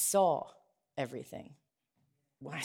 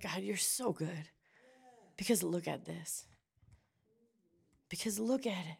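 soft praise; 8 dB quieter than usual. Shown as a demonstration. Praise voiced softly.

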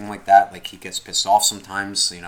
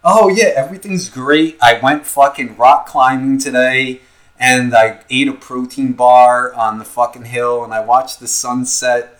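fake upbeat; 4 semitones higher, 7 dB louder, slower than usual. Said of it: "being like".

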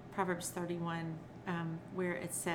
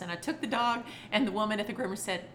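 ordinary talk, nothing marked

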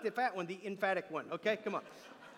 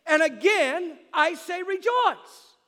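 high, loud, slowing proclamation of the verse; emphatic shout; 6 semitones higher, 7 dB louder, slower than usual. Acted. Preacher proclaiming what God says, emphatic shout. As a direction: shout it out, stretching "rejoice". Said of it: "here's what he says".

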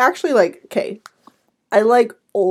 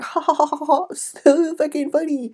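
higher, sarcastic fake laughter; mock laugh; 6 semitones higher than usual. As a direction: mimic a forced chuckle at a bad joke. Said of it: "they would probably be like".

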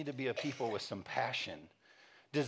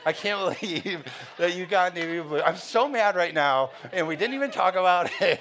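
mock angry wife; 7 semitones higher, 11 dB louder than usual. Wife upset over the navy blue mailbox paint, mock angry. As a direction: play up her fury, half laughing.

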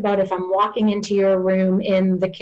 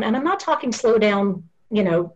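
ordinary delivery

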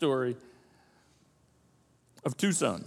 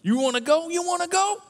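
mock angry father; 4 dB quieter than usual. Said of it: "It wasn't like".

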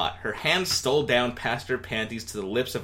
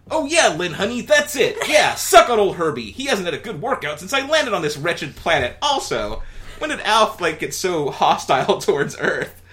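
higher, louder hammed-up Alf; Alf aroused; 7 semitones higher, 7 dB louder than usual. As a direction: overdo the pleasure.

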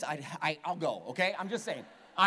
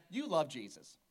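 ordinary talk, nothing marked

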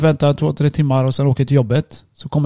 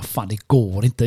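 slow despairing mutter; 5 semitones lower, 6 dB quieter, slower than usual. Retold or imitated. Despairing thought while driving on flat.

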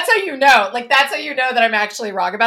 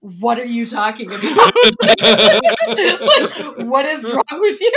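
laughing quote; 4 dB louder, slower than usual. Retold or imitated. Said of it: "everybody was just like".

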